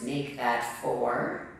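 a deadpan correction, low and unhurried; low wry aside. Acted. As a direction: drop low and slow for a dry aside.

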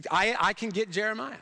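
ordinary talk, nothing marked

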